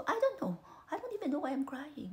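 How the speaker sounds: high-pitched imitation of crying confusion; mock upset, voice jumping high; retold tearful outburst. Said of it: "naiyak ako".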